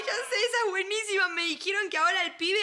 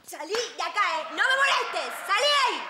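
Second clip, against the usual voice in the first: angry tale character; 4 dB louder than usual. Acted. Storyteller voicing a tale character, angry order. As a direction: build to a loud order to get out. Acted quote inside children's tale.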